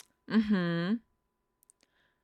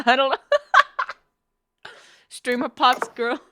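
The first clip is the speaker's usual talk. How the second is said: laughing; 4 semitones higher, 4 dB louder than usual. Real, not acted. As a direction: crack up while saying it. Laughing at own muddled phrase.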